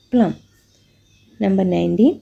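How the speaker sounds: normal talking voice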